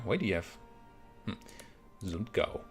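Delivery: ordinary delivery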